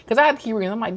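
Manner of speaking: usual speaking voice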